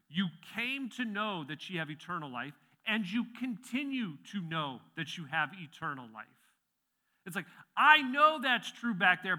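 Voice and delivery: high, loud voice of conviction; full-force preaching. Acted. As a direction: proclaim it boldly to the whole congregation.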